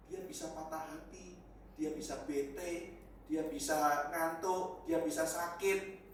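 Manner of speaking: slow, each item weighed; slow emphatic list. Real, not acted. Preacher lists a teacher's frailties to the congregation, slowly.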